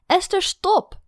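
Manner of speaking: high, loud stern command. A narrator's reading. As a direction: cut her off sharply. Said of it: "zei ik streng".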